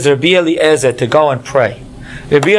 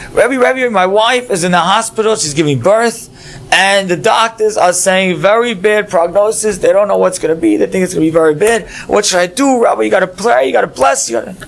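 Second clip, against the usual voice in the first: much higher, breathless panic; frantic; 5 semitones higher than usual. Acted. Student bursts in to the Rebbe, frantic high panic. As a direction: burst in desperate and rushed.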